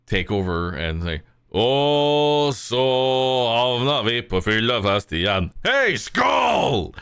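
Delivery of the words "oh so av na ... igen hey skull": high, loud boozy shout; rowdy drunk warrior. Acted. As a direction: lead the toast like a drunk Viking. One warrior leads drinking toast.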